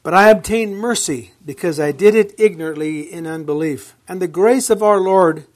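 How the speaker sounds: measured, ringing Bible reading; proclaiming scripture from the pulpit. Acted. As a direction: read the verse out to the congregation. Reads scripture aloud.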